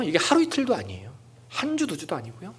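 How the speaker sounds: usual speaking voice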